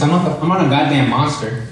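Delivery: normal talking voice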